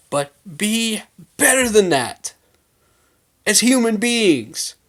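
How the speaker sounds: slow, high, stretched-out appeal; emphatic plea. Real, not acted. Fan urging fellow fans to behave, emphatic plea.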